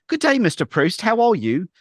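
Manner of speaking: high, prim mock British accent; mock British accent reading the marathon email. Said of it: "prim and proper".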